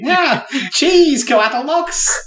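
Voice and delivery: very high, excited cartoon inventor voice; Wallace. Player getting into character as the strange inventor, Wallace impression. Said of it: "I'm trying to get into character".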